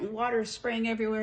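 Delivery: usual speaking voice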